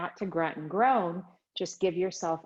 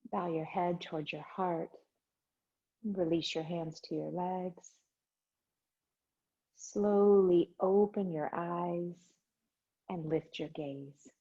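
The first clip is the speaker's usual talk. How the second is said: soft, unhurried breathing cues; calm yoga teacher voice; guided meditation; 3 semitones higher, 3 dB quieter than usual. Acted. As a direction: guide the class gently into the breath. Leads breathing and chant.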